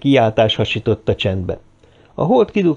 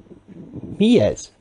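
involuntary cry; 8 semitones higher, much slower than usual. A narrator's reading. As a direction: let a startled question slip out. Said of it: "tört ki akaratlanul is Ferguson száján a kiáltás". (önkéntelen, rémült felkiáltás)

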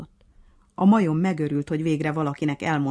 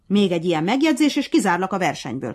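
shouting; 3 semitones higher, 7 dB louder, faster than usual. A narrator's reading. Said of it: "kiabált a nyúl".

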